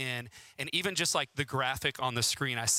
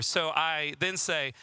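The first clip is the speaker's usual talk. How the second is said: ordinary talk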